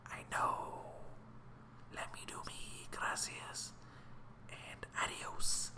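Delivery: soft unvoiced whisper; whispered brush-off. To his worried mom, a dismissive whisper.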